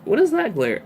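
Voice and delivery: sudden high puzzled question; surprised. Real, not acted. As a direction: caught off guard by the glare. Puzzled by glare on camera.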